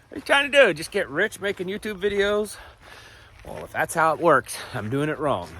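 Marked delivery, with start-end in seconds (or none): 0.1-2.6 higher-pitched, needling imitation of a critic; mock sceptical challenge. Imagined critic questioning the YouTuber's motives, mock sceptical challenge. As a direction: put on an accusing doubter, pitched up.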